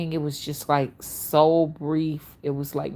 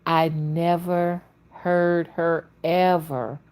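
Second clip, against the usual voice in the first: ordinary talk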